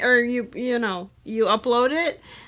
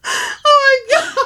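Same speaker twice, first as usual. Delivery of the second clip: laughing; 14 semitones higher, 3 dB louder, slower than usual. Real, not acted. Laughing exclamation.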